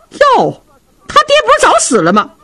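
high, sharp fake astonishment from the wife; shrill mock surprise. Wife Feng taunting her husband about his father, high mock surprise.

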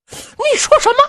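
high startled outburst; 惊怒质问. Acted. 县太爷惊怒质问. (又惊又怒地高声质问)

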